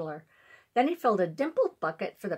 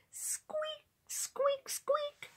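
very high, soft squeaks; small high-pitched creaks; creaking chair squeak; 7 dB quieter, much slower than usual. A narrator's reading. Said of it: "creaked the chair".

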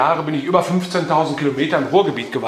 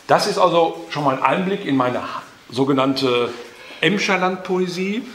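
ordinary talk

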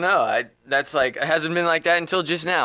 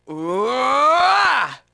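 rising shouted "ooh-ah"; battle cry; 6 semitones higher than usual. Acted. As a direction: let out a climbing fighter's yell. Battle cry while recording game lines.

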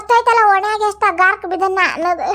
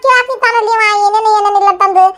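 louder, higher fuming complaint; angry rant; 5 semitones higher, 5 dB louder than usual. Acted. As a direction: rail at him. (ಸಿಟ್ಟಿನಿಂದ ಜೋರಾಗಿ)

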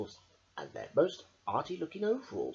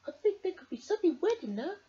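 put-on high, quick little girl voice; faster than usual. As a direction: voice Emily higher and brisk. Emily voiced in read-aloud story.

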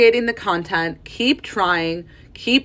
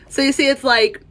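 usual speaking voice

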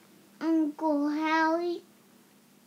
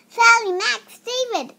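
yelled; 5 semitones higher, 6 dB louder than usual. Acted. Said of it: "Uncle Howie yelled".

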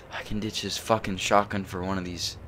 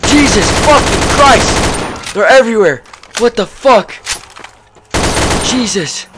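much higher, loud, terrified shouting; panicked yelling; 12 semitones higher, 13 dB louder than usual. Real, not acted. Player surrounded by a horde, panicked loud screaming. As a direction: scream in panic as they swarm everywhere.

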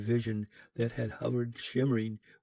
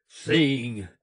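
louder, a harsh barked order; old man ordering the dream, louder and harsh; harsh command; 8 dB louder than usual. A narrator's reading. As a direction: command it roughly, one word. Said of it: "cried the old man harshly".

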